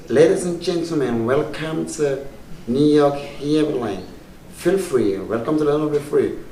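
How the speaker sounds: smooth American airport voice with stretched phrases; fine American announcer. Retold or imitated. Comedian mimics a New York airport welcome, fine announcer voice. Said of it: "you will hear fine voice, cool voice".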